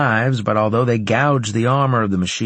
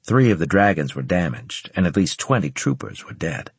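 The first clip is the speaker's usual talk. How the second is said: plain reading voice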